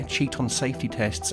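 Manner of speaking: ordinary delivery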